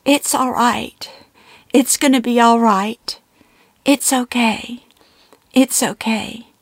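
a mother calming her sobbing daughter; comforting mother. A narrator's reading. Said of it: "she starts to comfort me".

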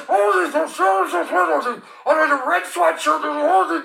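high, muffled loudspeaker announcement; garbled police PA. Retold or imitated. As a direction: imitate an unintelligible helicopter loudspeaker. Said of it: "I can't understand a word they're saying".

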